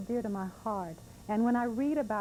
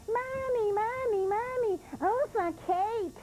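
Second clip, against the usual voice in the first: put-on little girl; 14 semitones higher, 3 dB louder, slower than usual. Little girl begging for cake.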